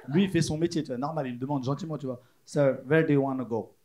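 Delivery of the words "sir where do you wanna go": slow, low Pakistani-English driver voice; Pakistani-accented English. Acted. Cab driver kindly asking the passenger, slow Pakistani accent. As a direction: ask politely with a put-on Pakistani accent. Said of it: "c'est un Pakistanais".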